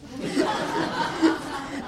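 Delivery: breathy burst of laughter; hearty laugh. Real, not acted. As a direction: laugh out loud at the joke. Laughs at the punchline.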